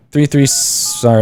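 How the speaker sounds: usual speaking voice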